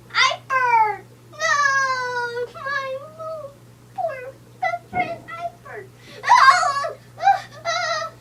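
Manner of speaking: high hammed-up crying shout; mock grief wail. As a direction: wail over a lost friend, playfully overdone. Character mourning fallen friend Iper.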